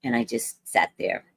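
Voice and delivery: ordinary delivery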